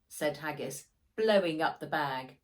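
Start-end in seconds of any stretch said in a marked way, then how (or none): none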